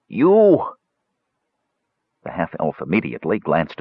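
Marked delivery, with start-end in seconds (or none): 0.1-0.6 rough croaking cry; hoarse croak. A narrator's reading. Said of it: "a hoarse voice croaked".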